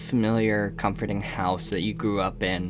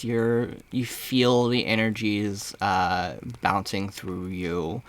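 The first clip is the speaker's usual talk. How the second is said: guided meditation; 3 semitones lower than usual. Acted. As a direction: ease the player into a trance, unhurried.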